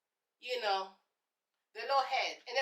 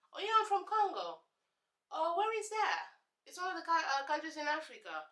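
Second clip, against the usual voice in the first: lightly mocking herself; 4 semitones higher, 4 dB quieter than usual. Herself answering classmate.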